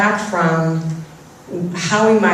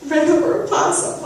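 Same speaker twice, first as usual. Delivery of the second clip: upset; 9 semitones higher than usual. Retold or imitated. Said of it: "I'm, like, so upset".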